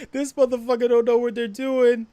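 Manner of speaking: loud, high mocking outburst; incredulous shout. Trainee pilot ranting about a clueless classmate, loud shout. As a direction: bust out loud in disbelief. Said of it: "you were like".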